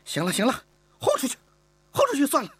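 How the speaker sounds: exasperated. Acted. Mistress ordering the girl thrown out, exasperated. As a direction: throw her out, fed up.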